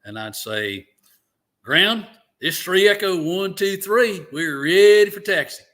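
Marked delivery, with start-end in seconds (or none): 1.6-5.6 put-on old Alabama accent. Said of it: "I'll give you a little taste of that".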